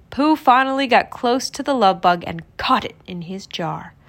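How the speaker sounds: ordinary delivery, nothing marked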